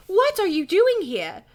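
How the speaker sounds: high, loud, drawn-out question; exclaiming. A narrator's reading. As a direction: exclaim it in slow astonishment. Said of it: "Stacy exclaims".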